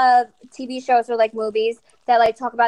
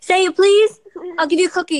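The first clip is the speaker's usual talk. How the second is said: higher, louder eager bribe; bribing; 7 semitones higher, 4 dB louder than usual. Real, not acted. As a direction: promise the treat to coax her. Offering toddler a cookie.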